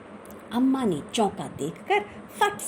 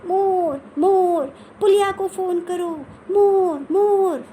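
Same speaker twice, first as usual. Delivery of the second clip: high, loud panicked yelling; frantic granny yelling about a peacock; shouting; 9 semitones higher, 7 dB louder than usual. Acted. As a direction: scream for help at the top of her voice. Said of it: "तेज़ आवाज़ में चिल्लाने लगी".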